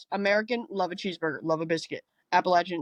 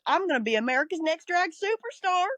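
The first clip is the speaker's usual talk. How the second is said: much higher mock Southern drag queen voice; Southern accent, pageant-bright and raised; Southern drawl; 8 semitones higher than usual. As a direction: pitch it up in a put-on Southern accent. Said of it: "she talks like this".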